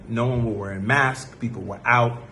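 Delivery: usual speaking voice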